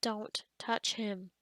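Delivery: low, slow acted warning; stern warning. Warning guest off her frog.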